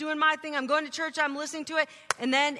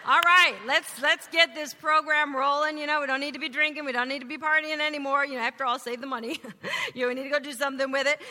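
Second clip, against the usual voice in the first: louder and higher, rattled off fast like a bossy new convert; mocking the eager convert; 4 semitones higher, 6 dB louder, faster than usual. Teen mimicking her family's new church rules, loud and fast. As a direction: ham up the born-again sibling, rushing the list. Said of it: "So I'm like".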